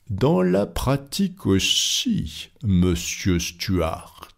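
very slow, measured correction; unruffled rebuttal. Acted. Fogg counters Stuart's doubt, slow and composed. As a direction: space out every word, perfectly composed.

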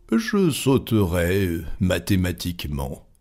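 slow, level, composed answer; unruffled reply. Acted. Fogg answers the agitated protest, slow and unruffled. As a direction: say it slowly, completely unmoved.

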